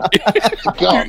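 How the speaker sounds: high, slow exasperated put-on voice; mock scorn. Suspect explaining to the soldiers, slow high mock. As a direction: spell it out to an idiot. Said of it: "looking at us going".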